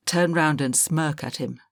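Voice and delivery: ordinary delivery, nothing marked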